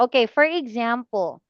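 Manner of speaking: ordinary talk, nothing marked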